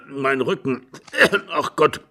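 groaning words between coughs; coughing old man. A narrator's reading. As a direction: wheeze out the complaint through coughing. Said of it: "Und der Greis hustete endlos".

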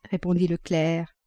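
usual speaking voice

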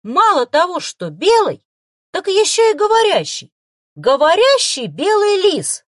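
very high, very loud gushing delight; bursting with wonder; rapturous. Acted. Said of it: "исполненным восторга голосом".

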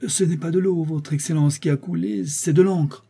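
high-pitched servant's confession; lightly voiced butler blurting out bad news; flustered servant. A narrator's reading. (maître d'hôtel affolé, voix aiguë)

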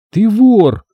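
high-pitched angry accusation; angry cry. A narrator's reading. Client accusing the hired thief, angry cry. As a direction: cry it out crossly, pitch leaping. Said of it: "сердито выкрикнула Андра".

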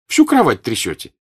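loud, quick teasing; playful scolding girl. Acted. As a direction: daughter teasing her giggling mother. Daughter teasing laughing mother.

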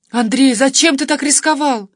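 high, loud, agitated question; alarmed reproach. A narrator's reading. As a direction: scold him out of worry. Alarmed reproach to rescuer lover.

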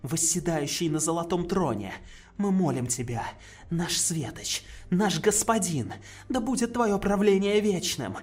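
prayer building from hushed to shouting; fervent prayer. Acted. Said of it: "поддержали её мантру изгнания".

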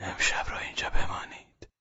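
too tired to raise his voice; faint whisper. Acted. The sick man whispers to the guest to stay the night.

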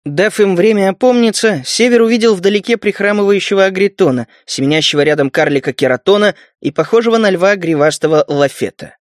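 ordinary delivery, nothing marked

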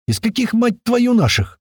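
high-pitched, exasperated demand; exasperated outburst. A narrator's reading. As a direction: lose patience and blurt it out high. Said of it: "не выдержал Слива".

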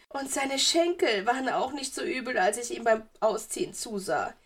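put-on high, dreamy girl ghost; schwärmendes Geistermädchen. A narrator's reading. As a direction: gush over him in a girlish voice. Narrator voices smitten ghost girl. (hoch und mädchenhaft schwärmend)

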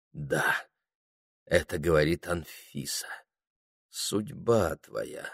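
low, soft, slowing to the end; hushed solemn answer. A narrator's reading. Анфиса answering through the blizzard, hushed. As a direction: answer low, like fate itself.

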